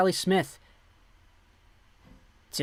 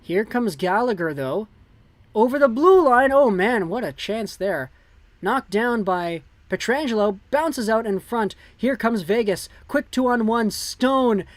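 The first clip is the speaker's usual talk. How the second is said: rising play-by-play; 4 semitones higher, 3 dB louder than usual. Acted. Live hockey play-by-play, building.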